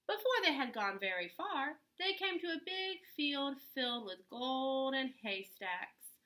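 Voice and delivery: ordinary delivery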